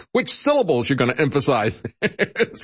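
amused, laughing through the words. Real, not acted. Laughing at the speech police.

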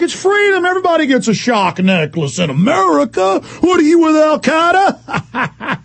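high, hammed-up zealot voice, dragged out at the end; mock patriot. Host mocking defenders of shock necklaces, jeering patriot. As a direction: parody a flag-waving accuser, breaking into laughter.

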